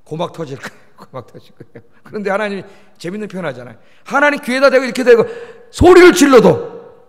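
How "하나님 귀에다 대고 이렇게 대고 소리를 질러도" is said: loud, raised burst on the phrase; demonstrative shouting. Shown as a demonstration. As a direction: call out as if into someone's ear. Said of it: "귀에다 대고 한번 소리 질러볼까요". (귀에 대고 소리치듯 크게)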